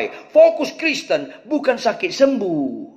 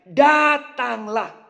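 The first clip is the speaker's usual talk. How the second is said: "Datanglah" drawn out very slowly, each syllable weighted; slow emphatic command; 5 semitones higher, much slower than usual. Shown as a demonstration. Preacher voicing Jesus calling Peter, slow and weighted. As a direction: stretch out "Datanglah" like a gentle but firm call. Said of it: "Karena penekanannya".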